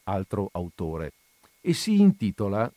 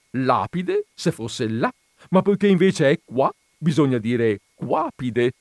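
jovial explanation; 5 semitones higher, 5 dB louder than usual. A narrator's reading. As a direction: explain the pun cheerfully. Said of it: "spiegò giovialmente". (spiegazione gioviale e scandita)

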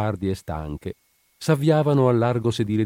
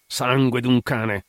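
slow, higher, exasperated oath; exclaimed curse; 4 semitones higher, 3 dB louder, slower than usual. A narrator's reading. Guard fed up with the victim's silence, cursing aloud. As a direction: curse it out, drawing the words long. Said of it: "esclamò la guardia Cosentino".

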